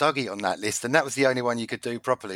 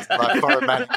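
mock foreign manager; 14 semitones higher, 6 dB louder than usual. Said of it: "he's not gonna say".

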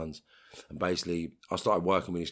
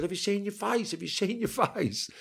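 much higher mock wife voice, panicked; hysterical wife; 13 semitones higher than usual. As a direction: imitate her shrieking in panic. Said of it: "she's just gone hysterical".

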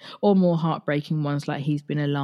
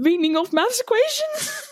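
laughing disbelief; 3 dB louder than usual. Real, not acted.

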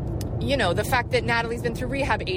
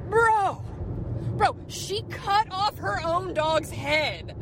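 shocked disbelief; 8 semitones higher, slower than usual. Real, not acted. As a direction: let the shock burst out, then drag the words. Shocked telling of a horror scene.

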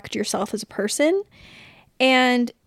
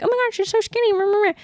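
gushing stranger; 11 semitones higher than usual. Retold or imitated. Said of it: "strangers in public weren't being like".

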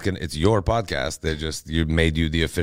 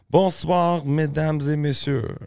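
high, drawn-out French show opener; stage greeting; 11 semitones higher, 4 dB louder, slower than usual. Acted. Comic greeting a French audience, high drawn-out stage welcome. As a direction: sing out a slow, grand greeting to the crowd.

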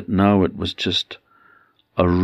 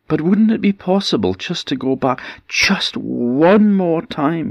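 high, pleading plea to go back once more; 11 semitones higher than usual. Acted. Husband begs Niamh for one last visit, high pleading. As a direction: beg her, pitched well up. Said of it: "he pleaded".

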